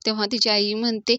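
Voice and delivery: usual speaking voice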